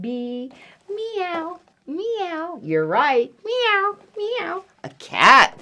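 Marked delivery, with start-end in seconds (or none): 0.9-2.6 high, soft little meows; small high-pitched meows; cat meow. Acted. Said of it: "Something goes meow". 3.4-4.6 high little meows; high-pitched kitty meows; cat meow. Acted. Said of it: "Something goes meow".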